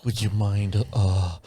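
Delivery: low, slow, creepy request; creepy whisper. Retold or imitated. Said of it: "It's creepier if he's like".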